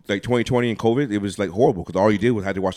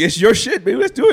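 hyped; 12 semitones higher, 8 dB louder than usual. Real, not acted. Hyping friend up.

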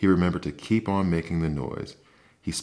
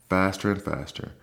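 usual speaking voice

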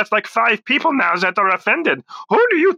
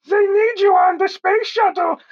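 high squeaky voice; 11 semitones higher than usual. Acted. Fake voice from off phone.

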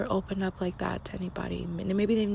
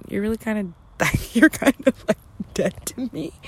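laughing mock dismissal; 8 dB louder, faster than usual. Voicing cold dismissal, laughing.

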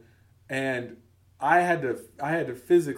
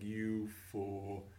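softer, pitying put-down; quiet mock scorn; 3 semitones lower, 10 dB quieter than usual. Coworkers judging him, quiet mock scorn. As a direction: murmur it with disdain. Coworkers shaking their heads.

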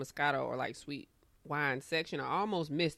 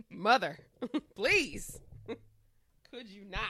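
exasperated; 4 semitones higher, 3 dB louder, slower than usual. Retold or imitated. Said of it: "I call her mother when I'm trying to make a point".

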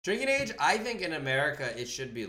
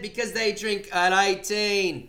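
put-on louder, slower London accent that slips Australian; attempted London accent; 8 semitones higher, 8 dB louder, slower than usual. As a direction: try a London accent, overdo it. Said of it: "talk in a London accent".

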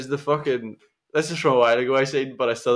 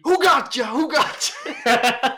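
high, loud playful film quote; Anthony Mackie; 12 semitones higher, 4 dB louder, much slower than usual. Retold or imitated. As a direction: shout it like the movie scene. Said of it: "Just Anthony Mackie".

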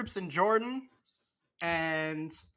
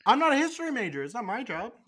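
higher, louder mock indignation; mock indignant; 6 semitones higher, 4 dB louder, faster than usual. Real, not acted. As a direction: snap back in playful offense.

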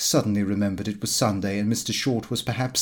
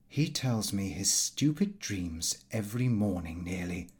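quiet complaint; 3 semitones lower, 9 dB quieter than usual. A narrator's reading.

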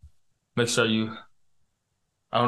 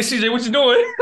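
laughing mock complaint; 10 semitones higher, 4 dB louder than usual. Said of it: "I was like".

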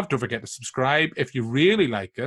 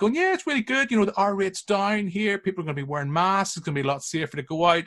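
cheery voice; 7 semitones higher than usual. Retold or imitated. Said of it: "you're like".

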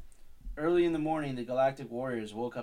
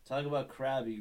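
ordinary delivery, nothing marked

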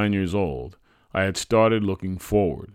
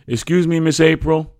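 put-on high, loud, polite boy's voice; polite child; 10 semitones higher, 9 dB louder than usual. A narrator's reading. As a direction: politely get a grown-up's attention, pitched high. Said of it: "I said politely".

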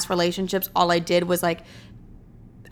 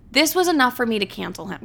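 ordinary talk

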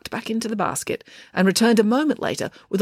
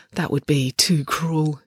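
usual speaking voice

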